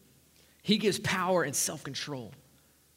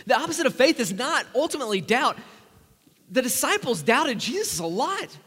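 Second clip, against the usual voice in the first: loud, raised preaching voice; preaching at full force; 3 semitones higher, 9 dB louder than usual. Real, not acted. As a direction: drive the point home to the congregation.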